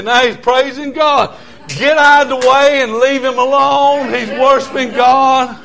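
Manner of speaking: high, drawn-out exhortation; fired-up country preacher; full-force preaching. Real, not acted. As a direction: proclaim it high and emphatically.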